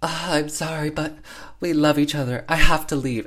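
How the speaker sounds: high, melodramatic mock confession; hamming it up. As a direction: declare forbidden love like a bad actress. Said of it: "definitely hamming it up".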